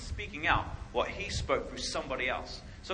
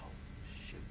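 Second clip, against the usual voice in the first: barely voiced, frozen in fear; scared whisper; 15 dB quieter than usual. Seeing the angel outside, a hushed scared whisper. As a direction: whisper it, too scared to speak up. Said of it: "So I was like".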